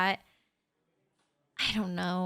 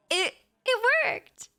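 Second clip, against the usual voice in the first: thrilled; 11 semitones higher than usual. Acted. Thrilled compliment made listener smile.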